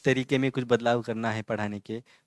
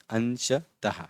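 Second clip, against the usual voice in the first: "अंशतः" slowly but more joined, ending in released h; measured syllables; much slower than usual. Shown as a demonstration. Teacher putting "अंशतः" back together, measured syllables. Word repeated with shorter gaps.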